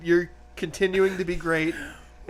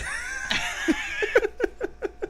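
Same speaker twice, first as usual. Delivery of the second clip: wheezing laugh; 5 dB quieter than usual. Real, not acted. Losing the trade.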